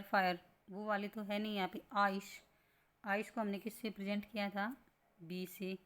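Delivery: ordinary delivery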